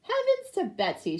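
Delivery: high startled exclaim; exclaiming waitress. Acted. Waitress sees the cat, exclaiming. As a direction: blurt it out in shock. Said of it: "she exclaimed".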